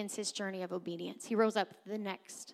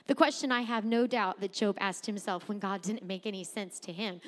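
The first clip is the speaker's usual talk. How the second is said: ordinary talk, nothing marked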